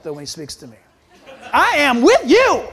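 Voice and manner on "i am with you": high, loud, drawn-out soulful voice; soul-like God. Acted. God reassuring the pastor with soul, big and slow. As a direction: belt it slowly as a soulful God. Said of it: "he sounds a little bit more soul-like".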